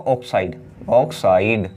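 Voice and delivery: ऑक्साइड said slowly, each syllable drawn out; ऑक्साइड twice, slow and deliberate for the class; slow drilled naming. Shown as a demonstration. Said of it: "वहाँ लिखते हैं ऑक्साइड". (ऑक्साइड धीरे-धीरे, हर अक्षर खींचकर)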